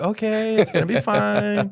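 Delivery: high, strained sing-out of calm; put-on reassurance. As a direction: cover a meltdown with forced calm. Dad returns pretending to be calm.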